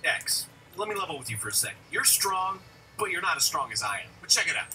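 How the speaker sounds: lightly voiced Zero. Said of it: "he's like".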